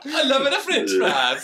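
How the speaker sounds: high, loud, laughing mock Italian; hammy Mario-style Italian; Italian accent. Friend copies the bad Italian.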